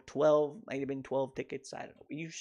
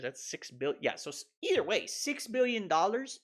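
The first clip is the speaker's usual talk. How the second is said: usual speaking voice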